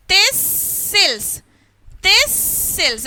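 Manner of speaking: "Thistles" as thisss-sils, the S held long; stressed S, silent T. Shown as a demonstration. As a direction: demonstrate "Thistles" with a lingering S and no T. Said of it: "Like this".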